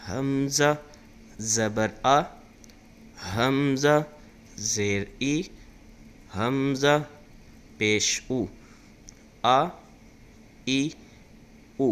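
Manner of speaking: loud, slow, drawn-out chanted syllables; Qaida recitation drill. Shown as a demonstration. Teacher drilling students in hamza vowels, loud chant. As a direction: call each syllable out for pupils to repeat.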